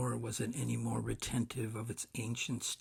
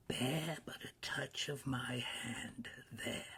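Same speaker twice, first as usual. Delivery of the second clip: slow, whispery ghost voice; hushed spirit; 3 semitones higher, 3 dB quieter than usual. A narrator's reading. Spirit offering its hand to Scrooge, slow hush. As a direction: draw it out, airy and hushed.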